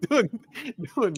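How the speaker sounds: loud, high laughing word; laughing loudly at the scene. Real, not acted. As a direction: burst out laughing on it. Laughing while retelling.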